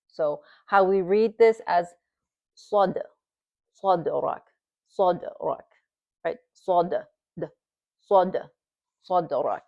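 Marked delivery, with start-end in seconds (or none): 2.6-5.7 صدرك with a light bounce on the dal; light qalqala. Shown as a demonstration. Teacher demonstrating light qalqala to students. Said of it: "He was very light". 6.5-9.6 صدرك with a light bounce on the dal; light qalqala. Shown as a demonstration. Teacher demonstrating light qalqala to students. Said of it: "there's a sukun on it".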